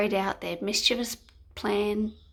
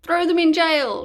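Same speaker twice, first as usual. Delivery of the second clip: louder, higher order; guards' shout; 6 semitones higher, 8 dB louder than usual. Acted. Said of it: "shouted the guards".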